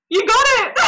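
high, loud laughing cheer; laughing shout of triumph; excited cheer. Retold or imitated. Midwife cheering at birth.

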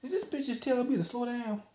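high, disbelieving inner voice; incredulous thought. Retold or imitated. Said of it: "I'm thinking to myself".

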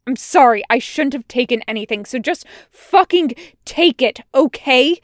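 agitated apology turning into a loud order; loud snapping. A narrator's reading. Wash paces the road before Tucker, snapping. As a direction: snap loudly, pushing the apology at him. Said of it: "Wash snaps louder".

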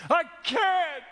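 high, desperate cry for help; breakdown. Acted. Man shouting to God as he breaks down, desperate high outburst. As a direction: snap and shout it out, pitch way up. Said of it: "I snap. I break down".

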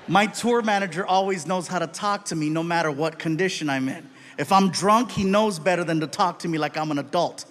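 ordinary talk, nothing marked

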